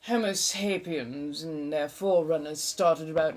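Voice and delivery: put-on a lightly held eighteenth-century colonial American accent; a mild colonial American accent, chatty and theatrical. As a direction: keep the old colonial American lilt going as you chat. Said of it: "the accent is, uh, roughly seventeen hundreds colonial American".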